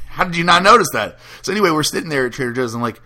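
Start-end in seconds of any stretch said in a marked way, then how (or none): 0.1-1.2 higher, louder incredulous question; mock-appalled, voice jumping up; exasperated disbelief. Host retells reaction to a missed wart, exasperated.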